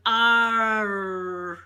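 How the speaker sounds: mock the learner's attempt; imitating failed r. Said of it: "they would be going".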